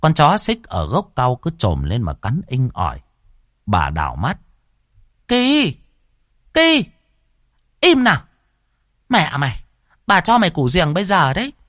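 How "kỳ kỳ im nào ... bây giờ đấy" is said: very high, sharp grandmotherly yells; scolding the dog. A narrator's reading. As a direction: shriek the short commands, then rattle off the threat. Grandmother scolding her barking dog.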